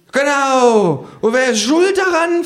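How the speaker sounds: high, griping kangaroo voice; cursing kangaroo. A narrator's reading. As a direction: grumble it high like a fed-up kangaroo. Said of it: "flucht das Känguru".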